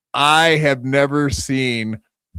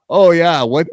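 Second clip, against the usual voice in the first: put-on Irish accent; 7 semitones higher, 5 dB louder than usual. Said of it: "I can't do an Irish accent".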